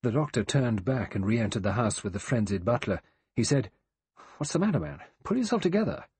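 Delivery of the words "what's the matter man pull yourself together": higher-pitched, urgent reproof; brisk rebuke. Acted. Doctor tells the frenzied butler to pull himself together, brisk rebuke. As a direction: pull him up short.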